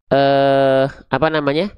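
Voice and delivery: ordinary talk, nothing marked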